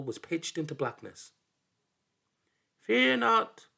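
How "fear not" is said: high, louder little voice from the dark; small voice. A narrator's reading. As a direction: call out as a tiny creature in the dark. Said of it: "came a small voice in the dark".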